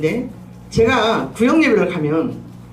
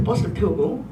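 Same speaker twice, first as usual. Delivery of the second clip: ordinary talk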